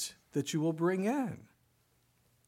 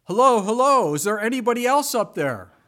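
loud, high desperate call; yelling out; 8 semitones higher, 12 dB louder than usual. Retold or imitated. Jack yelling up after God's answer, loud high plea. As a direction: shout up for someone else. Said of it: "And then he yelled out".

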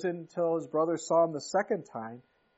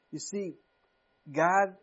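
ordinary talk, nothing marked

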